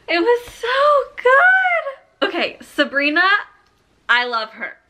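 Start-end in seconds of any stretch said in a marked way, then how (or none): 0.1-2.3 very high, drawn-out wail; squealing, tearful rave; overwhelmed gushing. Real, not acted. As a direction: cry it out slowly in bliss. Overwhelmed after finishing the book.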